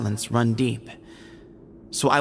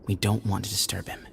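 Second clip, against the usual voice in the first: softer, hushed voice by a sickbed; 3 semitones lower, 5 dB quieter than usual. Acted. Guide at a sickbed, hushing the visitors. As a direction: speak low so as not to wake him. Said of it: "Keep your voices down".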